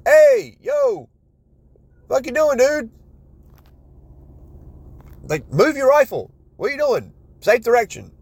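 high, loud shout at a careless shooter; yelled warning. Retold or imitated. A fellow shooter yells at the guy resting on his rifle, high and loud. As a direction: snap at him to move his rifle, yelling. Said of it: "I have to feel like somebody's like".

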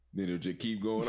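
ordinary delivery

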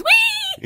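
very high "wheee" whoop; panicked squeal. Acted. Irishman panicking on the magic slide, high whoop. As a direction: let out a drawn-out slide squeal. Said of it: "Paddy Irishman panics and says".

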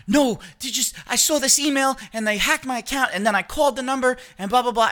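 high, rushed mom voice; mom retold. Mom explaining the scam email to her son, high and rushed. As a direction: gabble it high like his mother.

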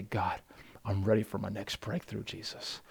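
soft, hushed prayer fading to a whisper; eager child's prayer. Shown as a demonstration. Pastor as an eager child praying softly to his dad. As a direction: murmur it like a trusting child. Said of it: "eager child ... daily and say".

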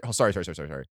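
ordinary delivery

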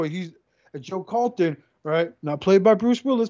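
ordinary talk

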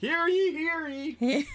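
high, loud proclaiming crier call; town crier. Pretend. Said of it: "Sequel-centric crier".

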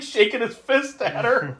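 ordinary talk, nothing marked

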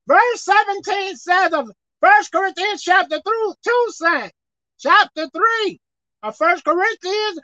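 high-pitched, emphatic verse reading building into shouted rebuke; forceful preaching. Real, not acted. Preacher rebuking tattoos on God's temple, forcefully. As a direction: drive the verse home, then shout the rebuke.

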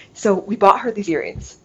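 ordinary delivery

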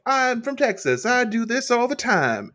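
high, slow mock Texas drawl; mock Texan. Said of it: "Casey's like".

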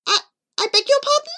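high, quick, stammered question; taken aback. Acted. Charlie answering the amorous pigeon, taken aback. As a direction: squeak it out in bafflement.

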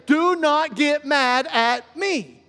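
slow, emphatic protest; defensive [name]. Acted. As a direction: push back word by word. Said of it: "I'm going to become defensive".

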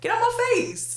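high, far-off sassy put-on voice; sassy dismissal. Dismissing the Bible-quoting hypocrite in a high sassy voice. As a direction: shoo her off in a shrill put-on tone. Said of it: "dismissing a hypocrite".

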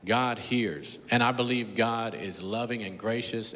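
ordinary delivery

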